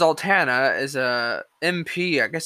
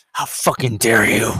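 lower, mock-indignant; sarcastic outrage; 7 semitones lower than usual. Vlogger mocking offended critics, sarcastic outrage. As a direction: fake horror, dripping sarcasm. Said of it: "I'm being extremely sarcastic here".

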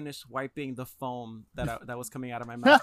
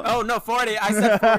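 mock indignant; 6 semitones higher, 10 dB louder than usual. Real, not acted.

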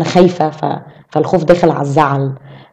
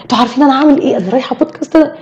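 high-pitched, eager announcement; loud excitement; 6 semitones higher, faster than usual. Retold or imitated. Telling friends in the cafe about a podcast, loudly excited. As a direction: imitate your own over-excited self. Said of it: "فصوتي قد كده في ال-، في الكافيه".

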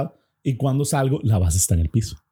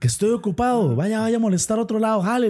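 high, irritated shout; angry yell; 10 semitones higher than usual. Retold or imitated. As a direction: shoo it away, fed up. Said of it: "enojadísimo y pego un grito".

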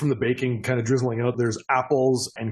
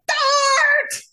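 high, piercing shout of greeting; sudden delighted yell; excited shout; 7 dB louder than usual. Acted. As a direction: yell it out in a high little gnome voice. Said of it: "all of a sudden you get".

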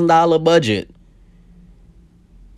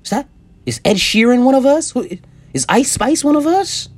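higher, eager mock Irish fans asking; hammed-up hopeful who-is-one-of-us voice; imitating Irish people spotting a redhead celebrity, mock eager; mock excited Irish people; 9 semitones higher than usual. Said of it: "you think Irish people be like".

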